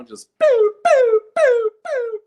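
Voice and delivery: very high, loud boo boo boo; mock shooting noises. Comic mocking cops picking off drivers with loud boos. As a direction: pop them off like shots in a barrel.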